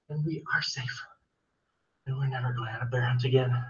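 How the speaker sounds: relieved whisper under the covers. Acted. Teacher whispering to the kids under the covers. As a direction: whisper it, safe at last.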